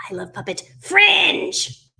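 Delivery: high, drawn-out burst on "Fringe"; stress on FRINGE. Acted. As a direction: build to a big excited "Fringe". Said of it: "what is the most exciting thing".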